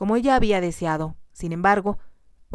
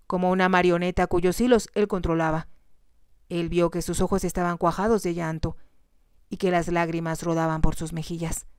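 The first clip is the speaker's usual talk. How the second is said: plain reading voice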